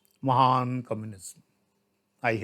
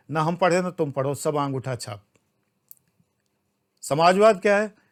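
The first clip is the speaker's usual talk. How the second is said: ordinary delivery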